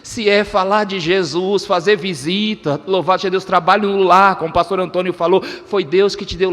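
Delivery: normal talking voice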